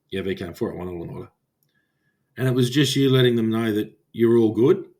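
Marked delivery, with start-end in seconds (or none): 0.1-1.3 low, soft radio reply; quiet reply. Retold or imitated. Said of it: "you just quietly go".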